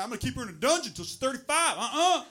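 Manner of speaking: loud, high Southern father caricature; Southern dad. Acted. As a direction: lay down the law as a Southern dad. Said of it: "I don't know why I make him Southern".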